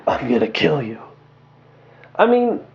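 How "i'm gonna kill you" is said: Batman threat. Retold or imitated. Lightly voiced Batman telling the Joker he'll kill him. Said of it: "he was said".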